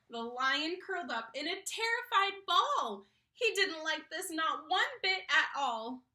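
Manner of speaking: put-on lively sing-song reading to kids; story narration. A narrator's reading. Reading a picture book to a child, animated storytelling. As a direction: read the rhyme aloud to children with bounce.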